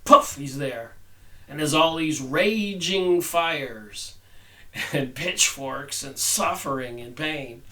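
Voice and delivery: ordinary talk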